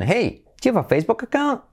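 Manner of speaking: high "Hey", climbing right up on "account"; exaggerated question intonation. Shown as a demonstration. Teacher modelling this intonation, high rising "account". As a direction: pitch it high and swing up to "account". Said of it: "Con esta entonación".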